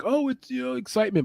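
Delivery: high mocking bettor voice; mock excitement. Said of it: "You're like".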